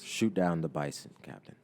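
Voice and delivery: low, controlled order; icy, understated command; cold. A narrator's reading. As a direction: give the order coldly. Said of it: "coldly responds".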